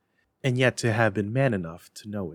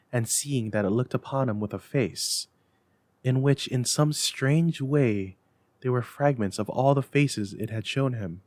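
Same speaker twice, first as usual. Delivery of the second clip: usual speaking voice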